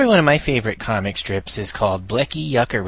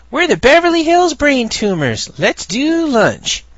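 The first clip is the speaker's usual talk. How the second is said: much higher, loud snooty drawl; snobby voice; 6 dB louder than usual. Acted. Reading the final comic panel, much higher snooty Hollywood voice. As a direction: stretch out lunch like a smug Hollywood snob. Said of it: "and it says".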